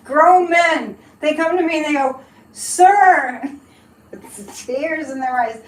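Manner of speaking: mocking Trump. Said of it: "when he's like".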